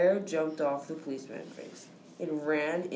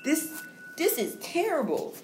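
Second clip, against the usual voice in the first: mother voice; 11 semitones higher than usual. Acted. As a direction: ask it like an anxious mom. Mother reacts.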